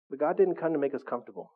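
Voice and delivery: usual speaking voice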